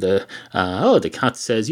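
ordinary talk, nothing marked

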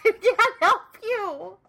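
high, slow laughing. Real, not acted. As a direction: laugh through the words. Laughing helplessly.

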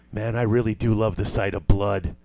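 low deadpan villain. Retold or imitated. Paul Sorvino's deadpan line.

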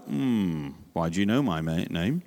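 low, suspicious hmm and question; a kid eyeing you with a bit of suspicion; wary child. Retold or imitated. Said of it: "they might be a little bit like".

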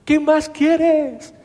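más aguda y muy lenta, alargada con énfasis; estíralo como quien no puede creer la duda; insistencia incrédula. Acted. Los hombres presionan a David. (pregunta insistente, aguda y muy alargada)

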